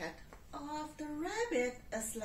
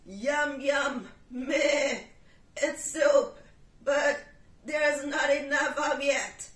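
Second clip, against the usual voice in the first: loud bleating goat; 3 semitones higher, 12 dB louder than usual. Acted. Goat complaining to the cat about the slice.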